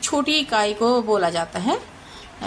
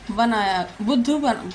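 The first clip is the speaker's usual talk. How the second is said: normal talking voice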